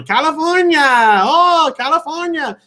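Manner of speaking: very high, delighted Olive Oyl cry; Shelley Duvall. Retold or imitated. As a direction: coo the place name way up high like Olive Oyl. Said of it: "Shelley Duvall's Olive Oyl talk".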